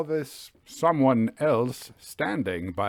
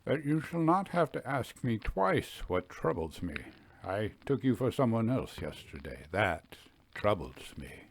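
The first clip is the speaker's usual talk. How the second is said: much softer, lower, barely above a whisper; near whisper; 3 semitones lower, 7 dB quieter than usual. A narrator's reading. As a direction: confide it in a hush. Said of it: "a tone but a little above a whisper".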